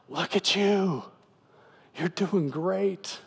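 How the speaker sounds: encouraging. Acted. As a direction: cheer him on as Jesus, stretching the words. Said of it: "Jesus is encouraging this guy".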